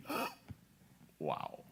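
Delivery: a stunned, hushed gasp of wonder; awed wow. Retold or imitated. Stunned by the huge stones, a hushed awed wow. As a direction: let the wow fall away into a quiet hush.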